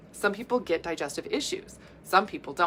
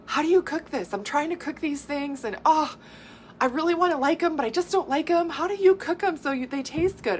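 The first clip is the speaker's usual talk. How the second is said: higher, exasperated pleading voice; imitated frustrated plea; 8 semitones higher than usual. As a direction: imitate someone at a loss, begging for help. Voices a frustrated person's message.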